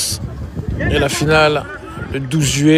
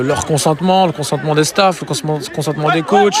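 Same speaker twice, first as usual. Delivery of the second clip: ordinary talk, nothing marked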